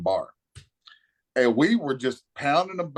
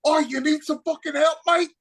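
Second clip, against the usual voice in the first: high-pitched, worked-up Cockney; crazy Cockney accent; 13 semitones higher, 3 dB louder than usual. Retold or imitated. Drunk friend offering the struggling driver help, crazy Cockney accent. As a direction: mimic a loud Cockney friend butting in. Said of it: "his crazy Cockney ass accent".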